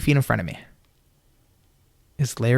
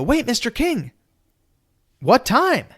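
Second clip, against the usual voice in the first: eager call; 10 semitones higher, slower than usual. Retold or imitated. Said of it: "I go".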